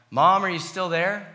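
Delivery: put-on a little boy calling from bed. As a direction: call out to Mom across the dark house. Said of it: "I would regularly call out".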